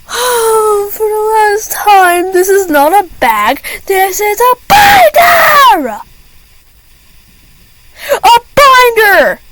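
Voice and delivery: high, slow, near-crying outburst; exasperated yell. Retold or imitated. Student pleading with teacher that it's a binder, high and wailing. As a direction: wail it like you're at the end of your rope.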